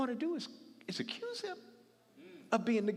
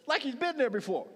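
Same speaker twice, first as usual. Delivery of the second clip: higher, louder, punch the word like; emphatic repeat; 7 semitones higher, 10 dB louder than usual. Real, not acted. Preacher repeating his point louder for the congregation. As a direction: hammer the point home.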